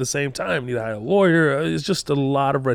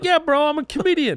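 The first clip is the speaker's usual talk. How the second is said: high exasperated reply. Retold or imitated. Own answer, high pitched.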